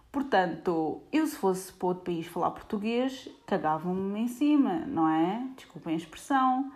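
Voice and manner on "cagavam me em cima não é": usual speaking voice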